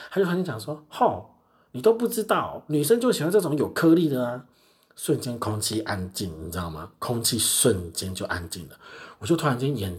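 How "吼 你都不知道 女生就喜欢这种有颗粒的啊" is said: bold customer. Retold or imitated. Customer blurting a crude remark, mock smug. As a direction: announce it like a shameless customer. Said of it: "突然语出惊人呢".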